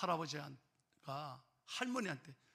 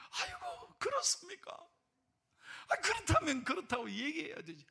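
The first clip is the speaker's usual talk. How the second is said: much higher, startled "아이고"; surprised exclamation; 10 semitones higher than usual. Retold or imitated. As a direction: gasp in astonishment. Pastor reacting to the revelation.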